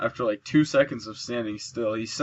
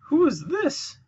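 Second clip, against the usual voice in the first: teasing voice; slower than usual. Pretend. Mock voice teasing enemy.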